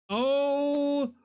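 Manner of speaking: loud, high, drawn-out cry of disbelief; real laughing outcry. Real, not acted. Streamer cracking up at a ruined model, laughing outcry. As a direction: cry it out very slowly as laughter takes over.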